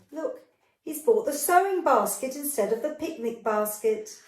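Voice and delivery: ordinary delivery, nothing marked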